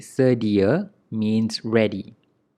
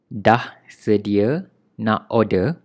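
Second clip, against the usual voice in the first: slow and careful, "order" with a Malaysian accent, "ordah"; 3 dB louder, slower than usual. Shown as a demonstration. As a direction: spell out "order" the Malaysian way, unhurried. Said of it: "we say it with, uh, Malay accent".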